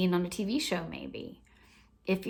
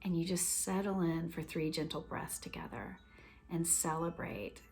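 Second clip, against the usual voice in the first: tender hush; 8 dB quieter than usual. Real, not acted. Closing, tender and hushed.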